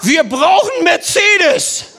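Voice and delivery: loud, high, drawn-out call; bold demand. Retold or imitated. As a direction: call it out slowly like a cheeky announcement. Acts out Günther's blunt demand.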